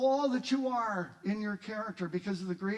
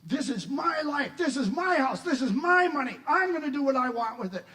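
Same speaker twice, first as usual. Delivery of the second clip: loud, high-pitched, emphatic stubborn refusal; stubborn defiance; 7 semitones higher, 7 dB louder than usual. Acted. Stubborn believer resisting God, defiant. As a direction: dig in and push back, louder with each claim. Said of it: "I stubbornly resist and say".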